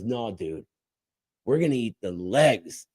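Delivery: low, hushed verdict from the group's smart guy; self-assured leader. Retold or imitated. Smart guy tells his buddies the plan, low and assured. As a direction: drop low and soft, laying down the decision.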